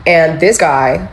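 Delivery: usual speaking voice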